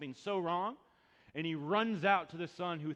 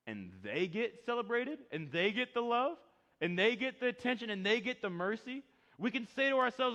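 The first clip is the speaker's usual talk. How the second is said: rising, louder preacher's build; preaching at full force; 8 semitones higher, 6 dB louder than usual. Acted. Pastor stirs congregation, preaching at full force. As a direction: preach it, stacking each phrase.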